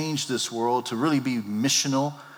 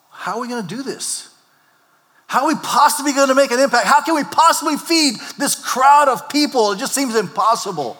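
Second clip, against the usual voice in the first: higher, louder, pleading in despair; desperate plea; 11 semitones higher, 7 dB louder than usual. Acted. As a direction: cry out to the Lord as if overwhelmed. Voicing desperate believers praying.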